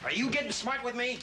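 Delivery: high-pitched, indignant challenge; angry challenge. Acted. Gunman challenging a hostage over smart talk, angry. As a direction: challenge him, voice jumping up.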